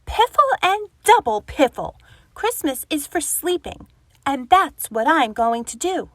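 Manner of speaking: an annoyed old badger shoos off a neighbour; grumpy badger outburst. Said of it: "spluttered Grumpy Badger".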